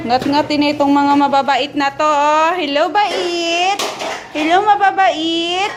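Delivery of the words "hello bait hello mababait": slow sing-song greeting to the pets; pet baby talk. Acted. Owner greeting her pets in slow baby talk. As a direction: coo slowly at the animals like babies.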